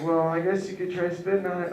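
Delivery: mock girlfriend. Girlfriend quoted.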